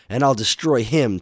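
determined vow. Acted. Mega Man vows.

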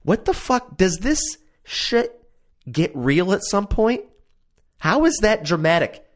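high, outraged disbelief; baffled viewer shouting at the TV; incredulous. Retold or imitated. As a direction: squeal it in disbelief. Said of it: "And I'm like".

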